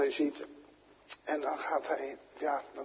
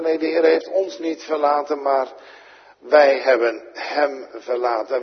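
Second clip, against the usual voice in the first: raised, ringing pulpit voice; louder sermon build to a punchline; preaching at full force; 4 semitones higher, 8 dB louder than usual. Acted. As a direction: preach it out to the congregation.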